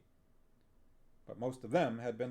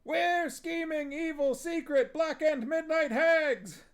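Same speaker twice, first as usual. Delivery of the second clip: witches yelling; 8 dB louder than usual. Acted. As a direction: yell it like gleeful hags. Witches chorus answer.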